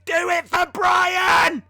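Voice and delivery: loud, slow, high cry; mock mantra scream. Streamer imitates screaming his gym mantra while lifting.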